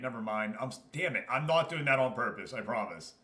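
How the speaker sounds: usual speaking voice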